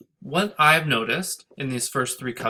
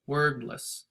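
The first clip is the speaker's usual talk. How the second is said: "wordless" with "word" strong and "less" soft, falling away; falling ending; 3 dB quieter than usual. Shown as a demonstration. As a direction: drop the voice on "less". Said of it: "We end on an unstressed syllable".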